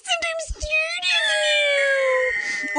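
high squeaky toy voice. Acted. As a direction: squeak out each avenue. Silly high voice listing avenues.

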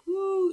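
high loud woo; crowd woo. Retold or imitated. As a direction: whoop at the hometown name. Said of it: "when I mention a place".

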